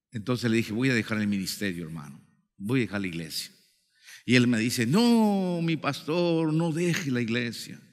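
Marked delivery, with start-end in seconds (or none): 0.7-3.5 low, subdued confession of defeat; weary and quiet, trailing down as if giving up; retold discouragement, low and soft; dejected. Retelling his wish to quit. 4.8-7.8 imitated plea with a long drawn-out "no"; acted-out protest, stretched and imploring; pleading. Brother begs him not to quit.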